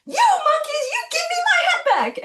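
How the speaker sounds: very high, loud mock outrage; cap salesman scolding. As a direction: squawk it way up high, hammed-up storybook fury. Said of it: "And then he says".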